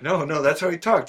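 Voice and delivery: normal talking voice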